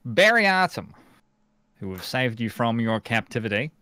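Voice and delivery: grand proclamation. Acted. Rescuer announces himself to freed salamanders, grand proclamation. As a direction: declaim it grandly, pausing between phrases.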